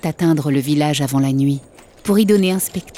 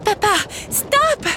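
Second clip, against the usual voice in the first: higher, slowed scream for her father; a girl shrieking to stop the cart; child screaming. Acted. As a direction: Bella screaming to her father over the hooves. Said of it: "Bella hurla".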